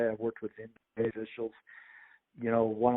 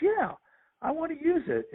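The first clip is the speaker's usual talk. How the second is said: much higher, mock-bright yes; imitating Pat Summitt. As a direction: play the coach's eager yes, pitched way up. Retells Pat Summitt's reply.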